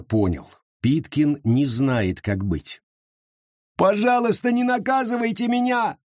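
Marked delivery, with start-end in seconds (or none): 3.8-6.0 high, tearful begging; sobbing plea. A narrator's reading. Mary sobbing to the crowd, begging high and tearful. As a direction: beg through sobs not to be punished. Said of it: "рыдала Мэри".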